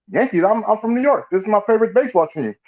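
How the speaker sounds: high, protesting outsider voice; naive New Yorker. Retold or imitated. New Yorker defending his Yankee hat, high protest. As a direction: play the clueless visitor.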